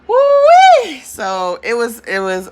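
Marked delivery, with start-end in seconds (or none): 0.1-1.0 high, loud hoot of delight; shrieking hoot at her own story; excited whoop. Real, not acted. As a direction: let out a big joyful woo-wee. Exclaiming after the story.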